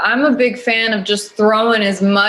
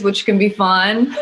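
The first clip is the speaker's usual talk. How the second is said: ordinary delivery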